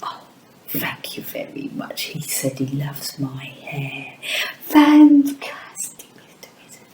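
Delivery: voiceless hushed whisper; soft secret whisper to the puppet. Acted.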